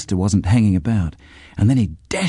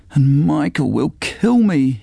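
slow, slightly higher moan; 5 semitones higher, slower than usual. A narrator's reading. Sean moans about his brother finding out. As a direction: groan it out in dread. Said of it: "he moaned".

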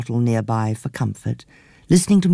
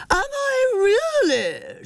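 high, slow shouted retort; shouting; much slower than usual. Acted. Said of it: "shouted Aunt Dizzy".

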